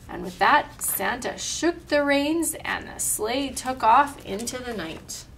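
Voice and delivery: ordinary delivery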